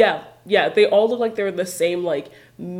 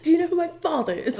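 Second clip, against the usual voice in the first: higher, fast spoiled-heir sneer; dissolute young heir; 10 semitones higher, faster than usual. Retold or imitated. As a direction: spit it like a spoiled rich boy. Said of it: "It's Lucius Malfoy is".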